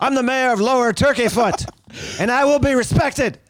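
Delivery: a hint of a self-important mayor demanding respect; pompous mayor. Cohost playing the offended mayor, lightly pompous.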